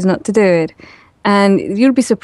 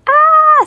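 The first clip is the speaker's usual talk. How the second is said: very high, loud shriek of fright; mock spider scream; 5 dB louder than usual. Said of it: "Scream, run out".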